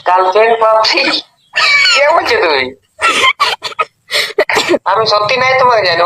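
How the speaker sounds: ordinary delivery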